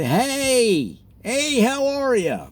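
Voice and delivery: slow, high beaming hey; hammed-up delighted hello to a neighbour; mock happy greeting. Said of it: "walk around town and say".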